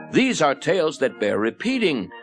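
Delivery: ordinary delivery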